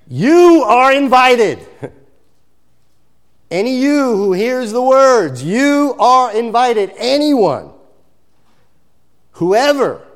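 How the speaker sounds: loud sermon invitation cry; preaching. Acted. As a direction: call it out to every listener.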